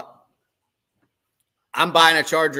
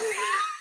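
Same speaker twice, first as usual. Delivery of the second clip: high squeal of laughter; 5 dB quieter than usual. Real, not acted. Laughing at partner's excuse.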